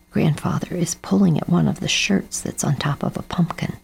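ordinary delivery

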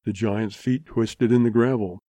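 plain reading voice

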